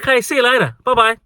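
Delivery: high-pitched mock-cheery send-off; see them off in a high, airy voice; dismissive farewell. Worker waving off a difficult customer, dismissive.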